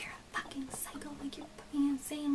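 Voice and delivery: hushed whisper of his insult. Retold or imitated. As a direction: breathe it out as a whisper. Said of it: "was like".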